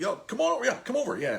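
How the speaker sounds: high-pitched, easygoing imitated beckoning; friendly calling over. Star inviting fans over for a photo, imitated friendly call. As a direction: mimic a cool guy waving people in.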